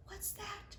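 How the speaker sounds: high, soft whisper; a small frightened whisper. Acted. The smallest squirrel whispering to her dad, high and soft. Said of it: "she whispered to her dad".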